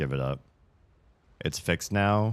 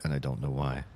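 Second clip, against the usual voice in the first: usual speaking voice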